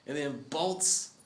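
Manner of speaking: ordinary talk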